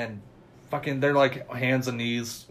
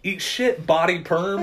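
high, louder retort, lightly acted; mock comeback; 6 semitones higher, 5 dB louder, slower than usual. Retelling the film, Tucker fires back at Chad in mock anger. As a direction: fire back the insult with glee. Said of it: "And Tucker goes".

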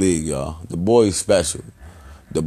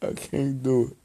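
choked up; 3 dB quieter than usual. Real, not acted. Breaking down over his cousin.

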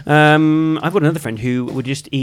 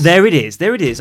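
louder, triumphant aha; triumphant gotcha; 5 semitones higher, 6 dB louder than usual. Real, not acted. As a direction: caught you out. Catching the admission.

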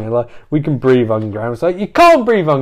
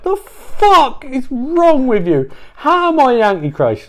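outraged squeal; 11 semitones higher than usual. Retold or imitated. Bobby to Eve, high put-on outraged voice.

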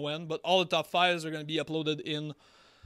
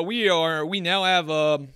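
normal talking voice

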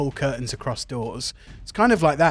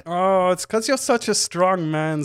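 lightly raised flamboyant coo; Eriken flirting; 5 semitones higher than usual. Acted. Eriken flattering Titus with a light high coo. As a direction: flirt playfully, a bit higher.

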